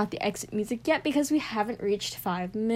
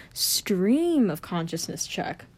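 slow whispered "Stream of consciousness check."; slower than usual. Shown as a demonstration. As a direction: whisper it slowly, like a mic test. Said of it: "whispered repeat".

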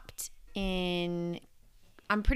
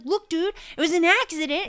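high, loud indignant snap; mock angry protest; 9 semitones higher, 7 dB louder than usual. Telling the angry husband it was an accident, high loud protest. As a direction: defend yourself, pitched way up and loud. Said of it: "I was like".

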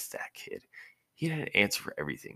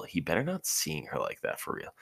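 plain reading voice

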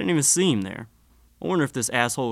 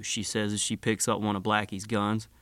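ordinary delivery, nothing marked

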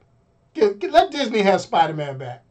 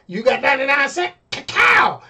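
shouting; 5 semitones higher than usual. Retold or imitated. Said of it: "talk about".

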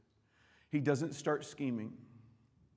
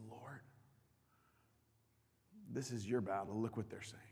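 soft, lowered prayer voice; Hezekiah's hushed plea; 3 semitones lower, 10 dB quieter than usual. Retold or imitated. Hezekiah praying to the Lord in the temple, hushed. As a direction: lay it before God quietly. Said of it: "and he says".

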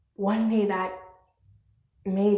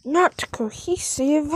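higher, frustrated scold at the gear; exasperated scolding; 8 semitones higher, 8 dB louder than usual. Real, not acted. Scolding the phone setup in frustration. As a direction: scold the gear, trailing off breathy. Scolding her own equipment.

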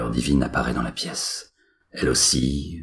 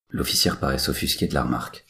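ordinary delivery

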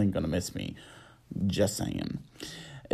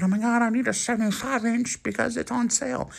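higher, lightly voiced greedy shopper; mock eager shopper; 11 semitones higher than usual. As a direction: parody a bargain hunter's gushing.

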